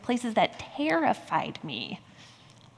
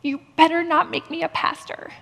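retold defiance; 8 semitones higher, 5 dB louder than usual. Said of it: "my next thought was".

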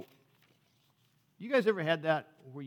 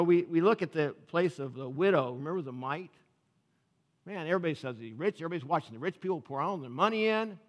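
normal talking voice

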